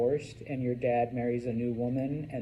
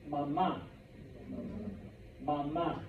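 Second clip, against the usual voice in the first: "mama" as ma-MA; stress on last syllable; 5 dB quieter than usual. Shown as a demonstration. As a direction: model "mama" for a class with the second A stressed. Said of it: "Pronounce that last A".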